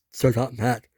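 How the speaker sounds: ordinary delivery, nothing marked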